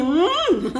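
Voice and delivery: long swooping "mmm" of relish; demonstrated yummy hum. Shown as a demonstration. Coach shows followers the sound, relishing hum. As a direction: hum as if savouring a bite. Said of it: "yang, yang bunyi".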